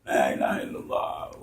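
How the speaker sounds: murmured adhan. Retold or imitated. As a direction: breathe the prayer words under your breath. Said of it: "I'm calling the adhan".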